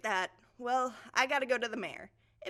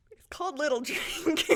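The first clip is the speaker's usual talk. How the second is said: laughing; 7 semitones higher, 6 dB louder than usual. Real, not acted. Laughing through own joke.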